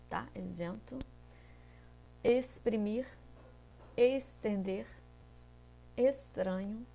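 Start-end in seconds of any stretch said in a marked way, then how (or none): 0.4-1.0 Isento with a z sound, rising at the end. Shown as a demonstration. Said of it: "O som é de Z". (isento com som de Z)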